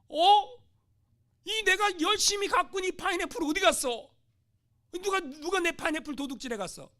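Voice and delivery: loud, high startled cry of loss; missionary discovers his harvest gone, shocked and loud; shocked outcry. Acted. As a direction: cry out as your crop has vanished. Missionary finds pineapples stolen.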